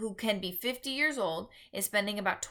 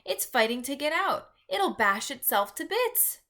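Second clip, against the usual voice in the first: excited cry; 6 semitones higher, 4 dB louder than usual. A narrator's reading. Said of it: "Sophie cried".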